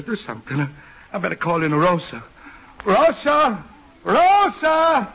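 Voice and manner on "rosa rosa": shouting a name offstage; calling out. Acted. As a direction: call her in loudly.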